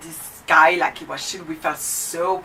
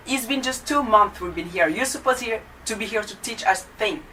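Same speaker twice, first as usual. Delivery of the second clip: usual speaking voice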